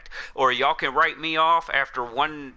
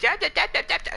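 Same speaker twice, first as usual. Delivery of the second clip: mocking yapping; faster than usual. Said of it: "some dude in my fucking ear just".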